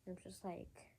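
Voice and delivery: normal talking voice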